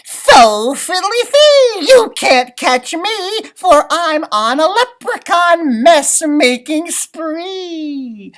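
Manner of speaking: high, loud, slow impish singsong; gleeful taunt of a leprechaun. Acted. Leprechaun taunting the class from the door, high and gleeful. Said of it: "with a wink and a call".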